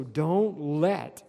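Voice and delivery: "let" very slow and loud; heavy, deliberate emphasis on "let"; stress on let. Real, not acted. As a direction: stretch "let" out and hit it hard. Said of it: "underline that word let".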